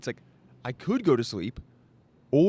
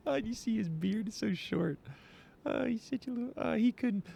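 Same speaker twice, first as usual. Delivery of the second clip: much higher, softer, catty tone; gossipy mocking Viking; 9 semitones higher, 4 dB quieter than usual. Vikings mocking another guy's beard, high and catty. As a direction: dish gossip about another guy. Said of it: "they did it in Viking accent".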